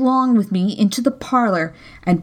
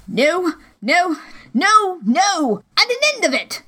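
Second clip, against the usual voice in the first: high, strained angry cry; the captain cries out in a rage; old captain crying out, high and furious; 9 semitones higher than usual. Acted. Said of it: "he cried once".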